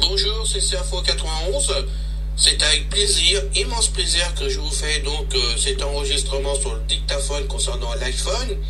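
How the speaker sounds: played back through a phone speaker; distant tinny playback; dictaphone recording. Real, not acted.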